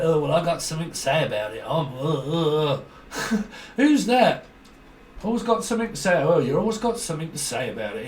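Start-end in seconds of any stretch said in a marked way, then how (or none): 0.0-3.4 mock busybody. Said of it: "I'll be like".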